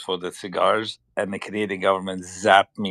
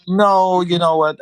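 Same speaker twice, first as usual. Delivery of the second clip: loud protest; 10 semitones higher, 9 dB louder than usual. Real, not acted. Protesting a rude suggestion.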